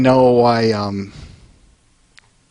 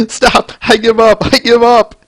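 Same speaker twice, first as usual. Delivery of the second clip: mock pleading monster; 10 semitones higher than usual. Swamp monster begging the pony, mock plea. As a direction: whine for mercy, half-hearted.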